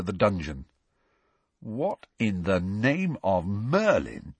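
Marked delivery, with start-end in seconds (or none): 1.6-4.3 slow, drawn-out question; slowly. Acted. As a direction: take it slowly, bewildered. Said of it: "said Ron slowly".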